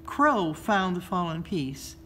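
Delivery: usual speaking voice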